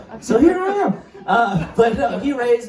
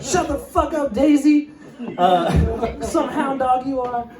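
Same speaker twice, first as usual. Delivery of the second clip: scolding the dog; 8 semitones higher, slower than usual. Retold or imitated. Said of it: "it's just like".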